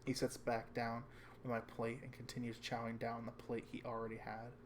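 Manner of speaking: ordinary talk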